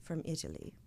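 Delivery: low, very soft imitation of an Italian man; soft-spoken. Host mimics musician saying his origin, soft-spoken. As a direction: mimic a quiet musician, voice dropped. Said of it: "I knew he had an accent".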